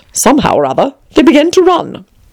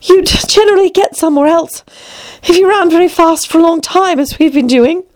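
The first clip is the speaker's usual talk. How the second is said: high-pitched, slightly winded Alice; panting a little; 7 semitones higher than usual. A narrator's reading. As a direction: explain it while catching breath. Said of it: "panting a little".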